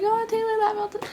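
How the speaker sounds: high, soft imitation of grandma; touched grandma. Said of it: "and she was like".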